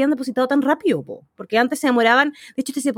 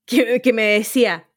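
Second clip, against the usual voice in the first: usual speaking voice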